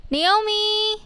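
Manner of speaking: second call pitched well up; reaching across the street for a friend. Acted. Calling out louder.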